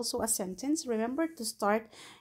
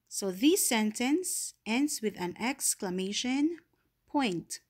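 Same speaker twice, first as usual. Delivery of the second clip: usual speaking voice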